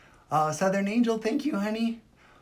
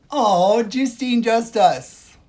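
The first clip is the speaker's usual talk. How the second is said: much louder, higher snooty squeal; mock shriek; 7 semitones higher, 12 dB louder, much slower than usual. Streamer mocking the commenter's name again, squealing louder.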